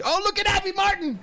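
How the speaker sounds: high mocking squeal; sneering high cry of a name. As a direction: squeak it out in a mock falsetto. Mocking squeal about Abby Martin.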